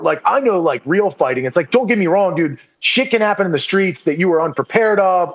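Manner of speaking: usual speaking voice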